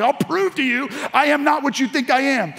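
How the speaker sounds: high, heated protest, hammed-up; defiant denial. Disciple proving to accusers he's no follower, heatedly defiant. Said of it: "and he's like".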